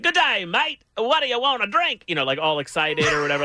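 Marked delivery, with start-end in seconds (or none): 0.0-2.0 high, bright-eyed mock Aussie waiter; fake Australian accent. Imagined Outback waiter greeting the table in mock Australian. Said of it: "someone would come up and be like".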